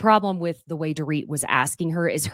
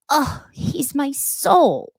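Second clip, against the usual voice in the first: swooning Dorit; 8 semitones higher, much slower than usual. Retold or imitated. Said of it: "Dorit adds".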